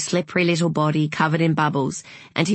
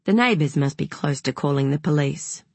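ordinary delivery, nothing marked

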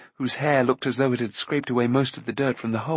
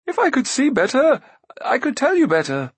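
high, raised princely voice; guileless prince calling; 13 semitones higher, 4 dB louder than usual. Acted. Prince in the dark to searcher, calling back. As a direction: answer high and loud, innocently.

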